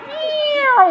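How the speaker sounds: high playful meow; cat meow. Shown as a demonstration. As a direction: meow to amuse the baby. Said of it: "making train noises".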